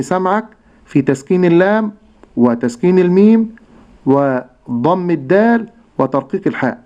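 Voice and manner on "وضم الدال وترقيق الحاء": normal talking voice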